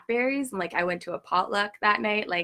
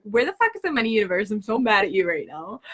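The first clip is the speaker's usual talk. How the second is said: mad at the universe; faster than usual. Retold or imitated. Demanding money from the universe, angry raised rant. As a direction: vent full frustration at the universe. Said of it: "I was so mad".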